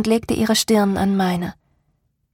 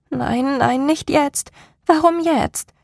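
higher-pitched, distressed protest; anxious inner voice; 6 semitones higher, slower than usual. A narrator's reading. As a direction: plead with yourself in rising worry. Anxious inner thoughts break out.

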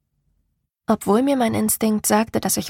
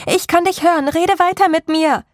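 much higher, loud urgent shout; 8 semitones higher, 5 dB louder than usual. A narrator's reading. Narrator yells into the woods urging Martin to keep talking. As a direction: call out to keep him talking. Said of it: "schrie ich".

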